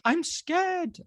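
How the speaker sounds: put-on high little-boy voice, frightened; imitated scared child. Imitating his son answering Dad at bedtime, scared. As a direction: play a scared small child. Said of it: "And he was like".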